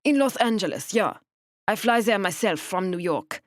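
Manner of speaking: put-on precise, stiff thick German accent. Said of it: "Her accent was the thickest".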